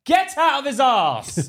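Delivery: loud, drawn-out high yell; retold yell. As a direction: bellow it slowly in mock outrage. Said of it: "And I was like".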